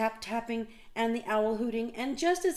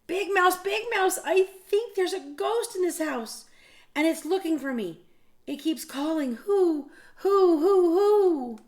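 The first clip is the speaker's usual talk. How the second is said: high, loud terrified mouse voice; frightened cry; 11 semitones higher, 8 dB louder than usual. Acted. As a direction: panic about a ghost, shrill and loud. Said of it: "feeling very frightened, he cried".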